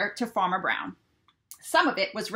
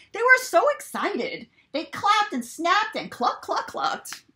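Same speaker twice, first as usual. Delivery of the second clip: much higher, clucking excitedly; excited chickens; 10 semitones higher, 5 dB louder, slower than usual. A narrator's reading. Narrator playing excited chickens clapping and clucking. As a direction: cluck it out like happy hens.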